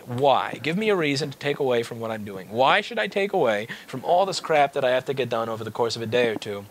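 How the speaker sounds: ordinary talk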